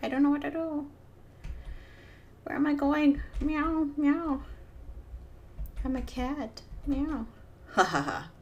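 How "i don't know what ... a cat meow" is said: high, silly cat voice. Pretend. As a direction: play the confused little kitty. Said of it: "Now he's like".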